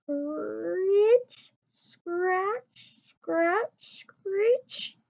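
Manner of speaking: high, rising scraping sounds of a hoe; hoe scraping noise. A narrator's reading. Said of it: "the noise of a hoe".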